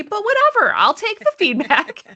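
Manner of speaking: high, pointed sarcasm; sarcastic. Real, not acted. Brushing off feedback on swearing, sarcastic. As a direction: shrug it off with a bite.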